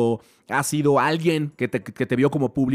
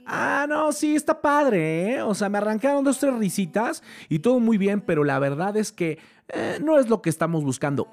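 agent brushing off; 7 semitones higher than usual. Retold or imitated. Agent brushing Buster off after the show, high mock-sweet voice. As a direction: play her as a patronising agent letting him down. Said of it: "Y le dice ella".

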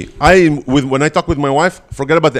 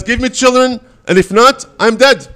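a sharp, higher demanding outburst; harsh demand; 8 semitones higher than usual. Retold or imitated. Wife confronting her husband with a harsh, sudden demand. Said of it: "That's a very harsh startup".

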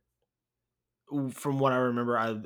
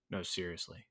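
quiet, lower, sobered reply; retold serious; 5 semitones lower, 8 dB quieter than usual. Player answering a teammate after the joke, suddenly serious. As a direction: drop low and soft, in earnest. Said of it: "he gets really serious and he goes".

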